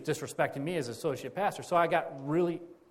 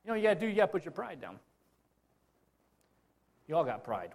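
ordinary talk, nothing marked

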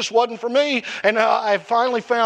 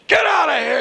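angry shout; 7 semitones higher than usual. Retold or imitated. Father tells him off.

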